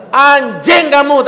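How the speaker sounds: loud, high, slow angry insult; preacher reenacting cursing the inmate who went back to prison; furious curse. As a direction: spit the curse at him slowly. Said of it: "tak gitu kan".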